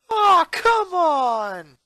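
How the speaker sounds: high, loud, drawn-out wail of frustration; frustrated cry. A narrator's reading. Boy losing his water bottle on a pothole, loud slow wail. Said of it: "he cried".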